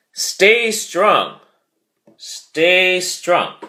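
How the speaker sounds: high, loud rallying call; loud orator voice shouting encouragement. Shown as a demonstration. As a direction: boom it out like a speaker filling the room. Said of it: "you might have an orator".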